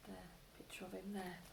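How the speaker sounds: breathy whisper aside. Real, not acted. Reader whispering to the child over the picture. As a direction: whisper as you show the picture.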